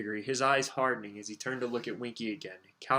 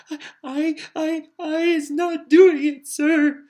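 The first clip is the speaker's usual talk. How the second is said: very high squeaky elf voice, gasping in panic; a terrified little elf gasping out her denial; gasping house-elf; 13 dB louder, slower than usual. Acted. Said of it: "Winky gasped".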